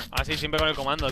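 ordinary talk

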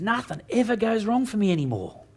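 high, self-satisfied boast; smug brother. Retold or imitated. Brother bragging to dad, smug and high. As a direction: preen as you say it. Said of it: "He goes".

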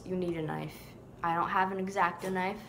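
ordinary delivery

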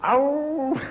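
high, drawn-out wolf howl. Pretend. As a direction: howl "ow" like a wolf, well above speaking pitch. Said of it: "your impersonation of a wolf".

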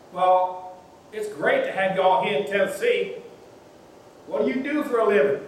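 a curious local leaning in; friendly Southern stranger. Retold or imitated. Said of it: "said a friendly voice".

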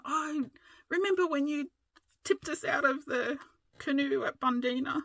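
high teasing imitation of husband; mock nagging. Imitating husband bringing up the old canoe story, high whine. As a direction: whine it back at him, mocking. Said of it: "so he starts going on".

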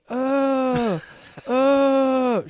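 hammed-up disgusted uuugh; mock disgusted groan. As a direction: groan like a classroom full of grossed-out kids. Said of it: "everyone's going".

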